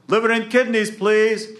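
high, loud eager order; calling out an order. Acted. Jack ordering at the butcher's, high loud call.

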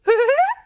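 high, loud little giggle. A narrator's reading. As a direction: let out a high-pitched giggle. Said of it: "she giggled".

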